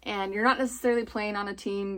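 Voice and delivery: usual speaking voice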